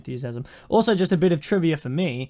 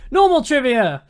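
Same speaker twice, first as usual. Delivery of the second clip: announcing; 7 semitones higher, 6 dB louder than usual. Acted. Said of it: "segment announcement".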